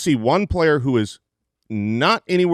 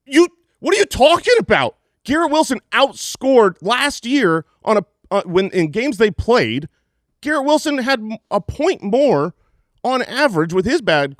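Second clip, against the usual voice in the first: fired-up rebuttal; 6 semitones higher than usual. Real, not acted. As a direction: push back hard, all fired up. Heated rebuttal of doubters.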